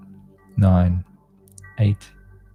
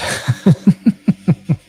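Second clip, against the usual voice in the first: bright burst of laughter; real laugh; 10 dB louder than usual. Real, not acted. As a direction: laugh out at the chaos. Laughs at her own morning.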